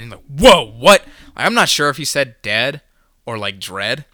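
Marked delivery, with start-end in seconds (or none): 0.4-1.0 loud, high startled outburst. Retold or imitated. Stunned listener reacting to coworker's rant, startled and loud. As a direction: blurt it out in alarm, much louder.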